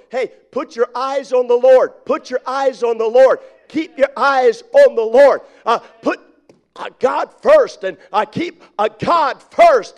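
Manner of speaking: loud, insistent, repeated exhortation; repeating same phrase loudly. Retold or imitated. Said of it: "saying over and over".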